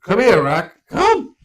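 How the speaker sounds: loud call across the room; calling a dog. Real, not acted. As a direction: shout for the dog to come.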